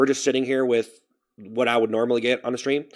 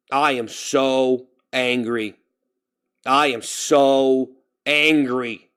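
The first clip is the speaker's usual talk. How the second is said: slow, drawn-out, measured declaration; declared anger; much slower than usual. Real, not acted. Streamer telling viewers he is angry, slow and deliberate. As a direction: stretch out every word, no shouting. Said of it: "I'm mad at everything".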